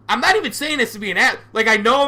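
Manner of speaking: loud, high, fast and defensive; sounding like an asshole. Real, not acted. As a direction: rush out a heated self-defence. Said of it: "I'm sounding like an asshole".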